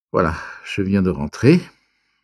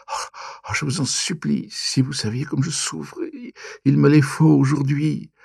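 higher, stammering, tear-choked begging; tearful pleading; 8 semitones higher than usual. A narrator's reading. Hypnotized cousin imploring him, tearful high stammering plea. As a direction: plead through tears, desperate. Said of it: "elle pleurait, elle, et bégayait".

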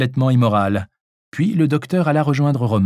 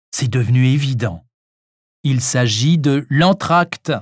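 ordinary delivery, nothing marked

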